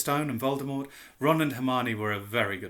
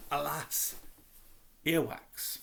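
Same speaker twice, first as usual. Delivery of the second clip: choked-up; 4 dB quieter, slower than usual. Acted. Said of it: "he choked".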